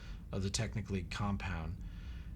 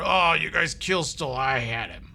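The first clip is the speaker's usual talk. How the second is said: loud, higher annoyed brute; a big oaf complaining; big lumbering guy, peeved; 5 semitones higher, 9 dB louder than usual. Acted. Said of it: "he lumbers over".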